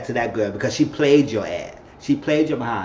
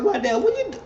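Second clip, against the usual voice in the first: much higher, squeaky put-on woman's voice; high mock woman. Voicing female character.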